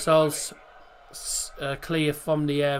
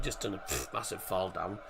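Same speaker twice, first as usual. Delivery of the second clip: sniffling; 5 semitones lower, 11 dB quieter than usual. Real, not acted. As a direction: sniffly voice from a cold. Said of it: "I'm sorry about the sniffling".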